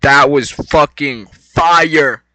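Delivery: slow, loud, stretched-out praise; real loud hype; hyped. Real, not acted. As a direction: drag every word out in awe. Hyped reaction to a fight.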